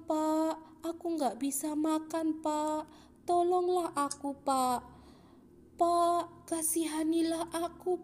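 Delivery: high, loud begging of a blind beggar; pleading beggar. Acted. (pengemis buta memohon belas kasihan)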